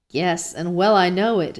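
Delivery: ordinary talk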